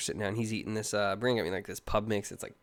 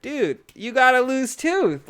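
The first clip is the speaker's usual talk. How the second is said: excited; 12 semitones higher, 7 dB louder, much slower than usual. Retold or imitated. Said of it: "That's exciting".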